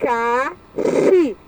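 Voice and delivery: "Abacaxi" split as a, ba, ca, xi with long pauses; syllable by syllable. Shown as a demonstration. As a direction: sound out "Abacaxi" in four separate beats. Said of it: "Tem quatro sílabas".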